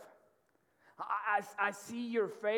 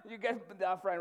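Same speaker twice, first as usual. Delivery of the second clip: ordinary delivery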